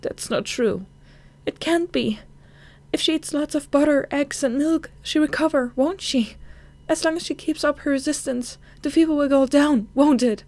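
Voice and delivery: cling to hope against the doctor; desperate pleading. Acted. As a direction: daughter begs doctor that mother will recover.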